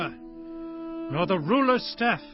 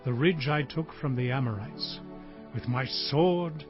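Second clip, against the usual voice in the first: low, subdued last bequest; dying patriarch; 5 semitones lower, 6 dB quieter than usual. Acted. Israel near death bequeaths land to Joseph, low and grave. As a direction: speak low and unhurried, softening toward the end. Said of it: "I am about to die".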